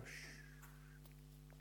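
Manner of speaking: barely audible whispered shh; whispered hush. Retold or imitated. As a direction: let the waves fall silent in a whisper. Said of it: "and the waves go".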